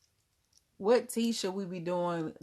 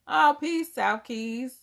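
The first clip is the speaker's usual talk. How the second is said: much higher and louder hello to a latecomer; excited greeting; 7 semitones higher, 9 dB louder, slower than usual. Real, not acted. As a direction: call out the welcome with delight. Greeting a late viewer.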